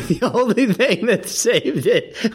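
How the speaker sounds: slow, high words broken by laughter; laughing. Real, not acted. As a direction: still cracking up while trying to explain. Still laughing at the performance.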